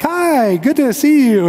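mock Kansas City neighbor opening the door, warmly; warm welcoming neighbor. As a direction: greet the visitor sweetly. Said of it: "they'd be like warm and welcoming".

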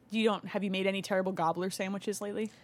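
usual speaking voice

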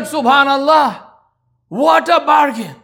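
high, slow, nearly shouted wonder; amazed exclamation. Retold or imitated. Wife marvelling at the trade, a slow amazed near-shout. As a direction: marvel out loud at the deal.

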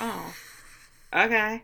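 quiet, hesitant oh, a beat, then okay; awkward pause. Retold or imitated. Podcaster retelling the club owner's reply to Ted, awkward pause. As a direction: leave a small beat before a muted okay. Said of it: "She pauses a beat".

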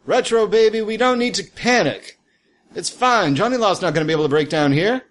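high, smooth disco diva voice; sultry diva. Acted. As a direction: purr it like a glamorous diva. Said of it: "And she says".

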